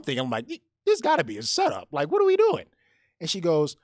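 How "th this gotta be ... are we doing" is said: much higher, squeaky alarmed "this gotta be a setup"; alarmed disbelief. Retold or imitated. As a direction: imitate your own panic as the thought hits. Panicked inner thought, much higher.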